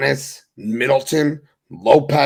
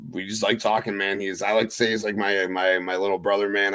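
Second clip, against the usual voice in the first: ordinary talk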